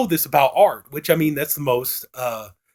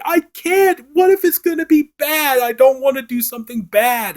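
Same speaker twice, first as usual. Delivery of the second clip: high, whimpering mock Cole; pitched-up fretful painter voice; whiny Cole; 11 semitones higher, 4 dB louder than usual. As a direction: whine it out like a scared kid. Cole whining in retold scene.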